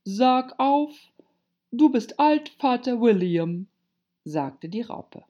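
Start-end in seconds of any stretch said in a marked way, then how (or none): 0.0-0.9 high, loud command; ponderous, bossy caterpillar. A narrator's reading. Caterpillar orders Alice to recite. 1.7-3.7 loud, pitched-up caterpillar voice; ponderous, bossy dictation; commanding caterpillar. A narrator's reading. Caterpillar dictates the poem title.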